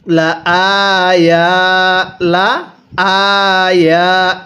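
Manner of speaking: লা আয়া slow, the stretch put on আয়া not on লা; tajweed recitation. Shown as a demonstration. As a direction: lengthen আয়া, keep লা short. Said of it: "লামের মধ্যে কিন্তু টান দিব না". (লা ছোট রেখে আয়া টেনে পড়া)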